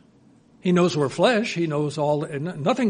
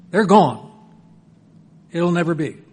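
emphatic warning; 5 dB louder than usual. Acted. God warning plan fails.

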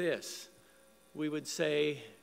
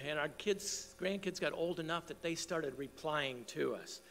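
usual speaking voice

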